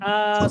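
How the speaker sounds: "a" sustained as an open aah; long a sound. Shown as a demonstration. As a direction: stretch "a" out evenly. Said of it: "we get an a sound".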